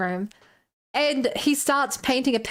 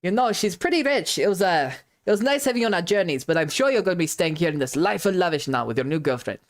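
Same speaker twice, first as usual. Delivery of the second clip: joking tease; 6 semitones lower than usual. Retold or imitated. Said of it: "I make a joke".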